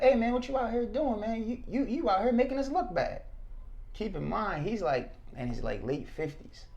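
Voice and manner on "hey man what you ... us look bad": higher mock voice of a nosy older man; lightly voiced older stranger, accusing; a hint of a scolding older man; imitating the older man. Older man accusing him again.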